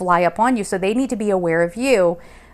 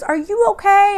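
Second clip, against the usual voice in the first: high, louder worried question; concerned stranger; 12 semitones higher, 4 dB louder than usual. Retold or imitated. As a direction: ask with imitated concern. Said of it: "He goes".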